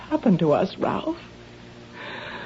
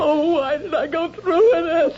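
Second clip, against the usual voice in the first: sobbing the words out, higher; wife sobbing to her husband in remorse; crying; 9 semitones higher than usual. Acted.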